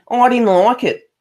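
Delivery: broad bogan Aussie. Shown as a demonstration. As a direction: lay the bogan Australian on thick with a laugh. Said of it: "Sometimes I sound so bogan".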